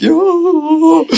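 high, long wailing cry; hammed-up high wail; imitated wail. Telling friends' laughter at him, high mock wail. Imitates himself overcome on couch.

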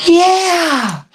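high, drawn-out eager yes; gushing eagerness. Retold or imitated. Acts out eager girls answering.

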